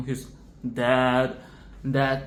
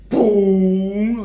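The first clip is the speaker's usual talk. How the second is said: loud hollow boom; mock belly-drum boom; booming thump; 4 semitones higher, 11 dB louder than usual. Shown as a demonstration. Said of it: "Like, that sound".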